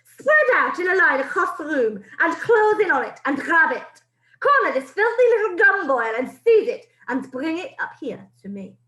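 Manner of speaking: put-on a witch ordering her coven to corner the boy; commanding witch. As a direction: command the hunt with cruel glee. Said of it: "screamed the Grand High Witch".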